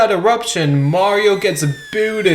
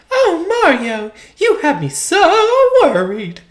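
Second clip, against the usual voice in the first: high, sweet princess voice; 13 semitones higher than usual. Acted. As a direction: play the rescued princess, high. Voicing Peach after rescue.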